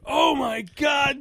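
high, slow "Oh, my God" shouted in amazement; mock shocked shout. Deli workers shocked he orders hot coffee, high mock shout. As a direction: shout it like the deli staff can't believe it. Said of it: "they were like".

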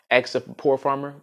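ordinary delivery

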